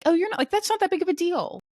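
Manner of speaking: high breezy voice brushing it off; lightly voiced friend waving it off; high reassuring mimic. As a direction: play the well-meaning friend, high. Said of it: "people who would tell me".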